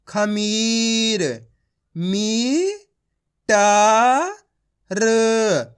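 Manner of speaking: isolated syllables held long, then slow blended words like आदमी and डायरी; drawn-out reading drill; direct syllable sounding. Shown as a demonstration. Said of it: "direct मात्रा का उच्चारण करके ही पढ़ सकते हो".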